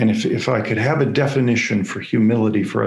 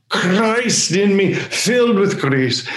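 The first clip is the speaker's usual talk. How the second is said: uplifted proclamation; 7 semitones higher, slower than usual. Acted. Uplifted repeat of God's words.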